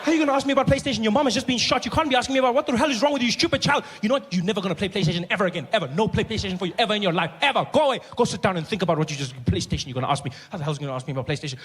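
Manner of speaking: high, loud scolding, fast in places; angry big brother. Retold or imitated. [name] scolding his little brother over PlayStation, loud and high. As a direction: tear into the kid for asking.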